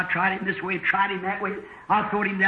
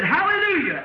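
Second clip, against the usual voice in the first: screamed hallelujah; 8 semitones higher than usual. Retold or imitated. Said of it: "He screamed and throwed his hands up".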